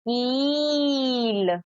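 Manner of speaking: "कील" with a very long, stretched ee; drawn-out long ई. Shown as a demonstration. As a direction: stretch the vowel of "कील" slowly, pitch arching up then down. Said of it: "थोड़ा लंबा खींचते हैं".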